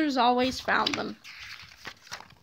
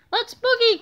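higher, eager shout to run; excited cry; 7 semitones higher than usual. A narrator's reading. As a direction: urge your friend to flee. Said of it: "cried George".

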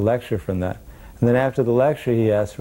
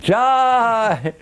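high, drawn-out shout of praise; cheer; 4 dB louder than usual. Retold or imitated. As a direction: call it out joyfully. Devotees cheer.

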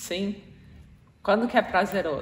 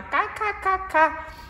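inner giggle; 12 semitones higher than usual. Retold or imitated. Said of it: "E por dentro você tá".

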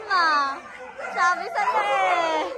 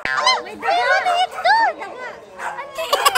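much higher, rising questions; startled squeal; 11 semitones higher, faster than usual. Real, not acted. Vlogger spots a rat near the pen, startled high squeal. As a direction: jump at a surprise.

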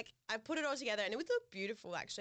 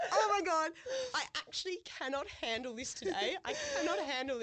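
mock meltdown; 6 semitones higher, 3 dB louder than usual. Retold meltdown over ball thrower.

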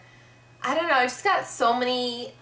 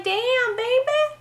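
put-on "Damn, baby." flung up high and a bit louder; catchphrase in a high voice; 4 dB louder, slower than usual. Shown as a demonstration. Reacting to a friend's brag, her catchphrase squealed high. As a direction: pop the phrase up into a squeak. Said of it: "And I'm like".

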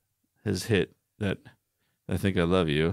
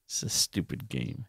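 unvoiced, much softer annoyed grumble; irritated mutter; 5 semitones lower, 10 dB quieter than usual. Real, not acted. Irritated player complaining about the board game, under her breath. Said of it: "Now I'm getting irritated".

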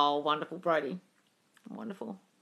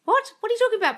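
high, loud incredulous questioning; baffled; 7 dB louder, faster than usual. Real, not acted. Host to viewer about a muddled comment, baffled. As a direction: demand it high, utterly puzzled.

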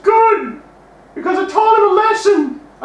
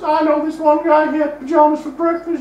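ordinary talk, nothing marked

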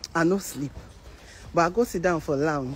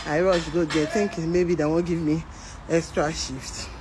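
normal talking voice

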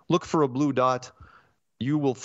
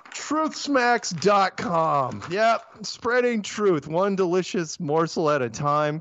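slow, higher, drawn-out ad pitch; hammed-up ad voice, higher and slowed; slogan read; 6 semitones higher, slower than usual. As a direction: sell the product like a radio spot. Reads the sponsor slogan.

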